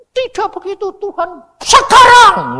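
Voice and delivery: very high mimicking voice; mocking high-pitched impression, slowing at the end; imitated objection. Imitates another man's objection to God.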